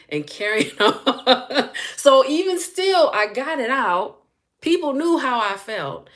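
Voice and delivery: high-pitched, laughing through the words; real amused laughter. Real, not acted. Recalling her cussing people out, laughing as she talks. Laughing at own younger outbursts.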